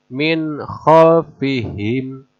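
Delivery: Min khalfihim very slowly, the n clear before kh; clear idhar. Shown as a demonstration. Teacher recites an example very slowly. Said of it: "harus idhar".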